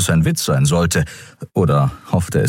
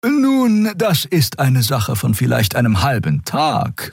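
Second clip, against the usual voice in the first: a higher, gentle female character voice; Xea's voice; 8 semitones higher, slower than usual. A narrator's reading. Xea urging Keru to join, lifted voice. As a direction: coax him kindly in a lifted voice. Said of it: "sagte Xea".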